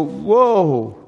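loud, drawn-out whoa of amazement; awestruck. Acted. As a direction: let out a big, amazed whoa. Acting out astonished reaction to verse.

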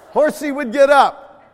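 loud, high burst; big, high exclamation; excited storytelling shout. Retold or imitated. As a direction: belt it out as the horse springs up. Acts horsey springing up in story.

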